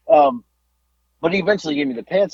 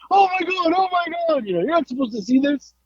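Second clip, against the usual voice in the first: high hammed-up fluster, getting softer; mock embarrassed wail; 13 semitones higher, 3 dB quieter than usual. Pantsed wrestlers to crowd, high hammed-up embarrassment. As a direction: squeal it up high like you've been caught. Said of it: "we're like".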